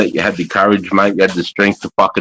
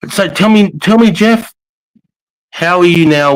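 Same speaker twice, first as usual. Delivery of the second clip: 1 eager prompt; 8 semitones higher, 3 dB louder than usual. Real, not acted. Host prompts guest loudly.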